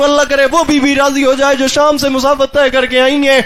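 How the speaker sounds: loud, high impassioned majlis climax; full-force preaching. Acted. Preacher rousing the mourners to lament at full force. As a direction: drive the mourners to weep, at full voice.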